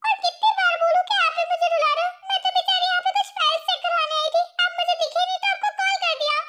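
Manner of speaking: high-pitched, hammed-up weepy protest; tearful self-pity. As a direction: play the poor wronged secretary, close to wailing. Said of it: "तुम क्यों रो रही हो".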